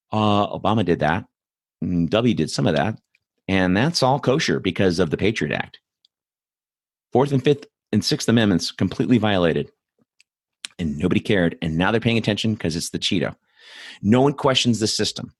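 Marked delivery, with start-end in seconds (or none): none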